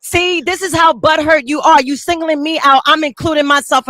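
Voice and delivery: usual speaking voice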